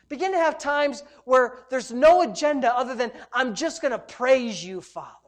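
insistent exhortation, key words punched; prophetic preaching. Real, not acted. Preacher delivers God's word urging praise, insistent prophetic exhortation. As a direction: press each repeated charge home with rising phrase ends.

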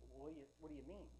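hushed, bewildered thought; quiet inner reply. Retold or imitated. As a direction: answer under your breath, lost. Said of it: "I just kinda responded".

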